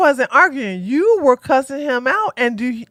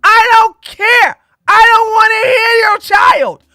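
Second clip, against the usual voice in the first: fed-up shout; 4 semitones higher, 3 dB louder than usual. Real, not acted. Ranting about kids in videos.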